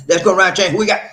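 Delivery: loud, high host shout; hype call-out. Real, not acted. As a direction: holler it like a train roll call. Host calls out the next commenter.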